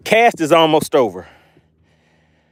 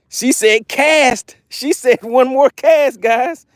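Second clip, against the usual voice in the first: excited; 9 semitones higher, 3 dB louder, much slower than usual. Real, not acted. Announcing chat vote.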